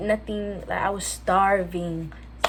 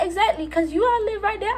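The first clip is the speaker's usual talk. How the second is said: incredulous retort; 13 semitones higher than usual. Retold or imitated. Sarcastic retort to friend's parents.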